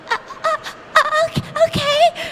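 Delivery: very high, stammering okay; high stammering nervous okay; flustered teen. Retold or imitated. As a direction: stumble like a scared young clerk. Flustered teen girl imitated.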